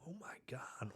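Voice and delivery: breathless, whispered disbelief; barely voiced, stunned brother; shocked whisper. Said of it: "looking at me like".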